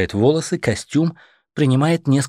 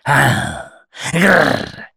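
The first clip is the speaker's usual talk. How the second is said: a rising growling roar, pitched up and loud; roar like a lioness; 3 semitones higher, 4 dB louder than usual. A narrator's reading. Woman roars at the man to frighten him, a lioness roar. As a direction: roar to scare him off for good. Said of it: "ревёт, словно львица".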